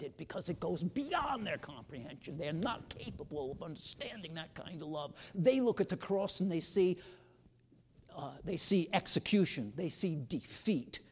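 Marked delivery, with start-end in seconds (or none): 5.3-11.0 building preaching. Acted.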